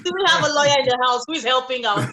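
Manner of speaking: playful laughing host. Real, not acted. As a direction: laugh as you joke.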